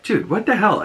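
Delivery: high mock disbelief; squeaky what-the-hell; incredulous. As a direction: pitch up in imitated confusion. [name] reacts to wrong fact.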